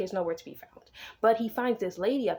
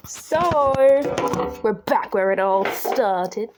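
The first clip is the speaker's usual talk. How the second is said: dramatic announcer; 8 dB louder, much slower than usual. Pretend.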